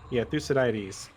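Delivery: ordinary delivery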